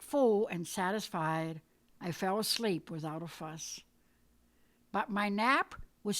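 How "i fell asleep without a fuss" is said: usual speaking voice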